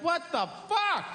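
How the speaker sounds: high outraged yell; shocked yell. Acted. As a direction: yell at it in disbelief. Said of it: "You're like".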